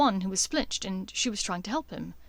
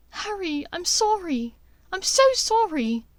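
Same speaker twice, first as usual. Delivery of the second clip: higher, rising distress; remorseful Hermione; 9 semitones higher, 5 dB louder than usual. Acted. Hermione apologizing to Harry, higher and distraught. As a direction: Hermione apologizes, close to tears.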